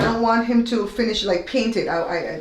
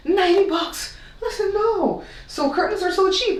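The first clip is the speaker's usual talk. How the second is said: exasperated; 10 semitones higher than usual. Real, not acted. Outraged at drape prices.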